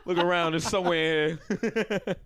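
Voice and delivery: can't be bothered to help; bored retail worker. Retold or imitated. Shop worker brushing off a customer, lightly mocked. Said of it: "He's like".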